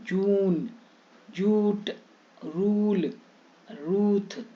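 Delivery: held "oo" in जून, जूट, रूल, रूथ; long oo vowel. Shown as a demonstration. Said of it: "अब बड़ी यू".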